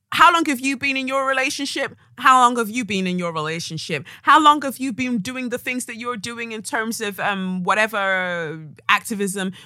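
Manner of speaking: mock the producer's questioning; imitating the producer. Retelling the producer's questions to the panel, in a mimicking voice. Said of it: "She goes".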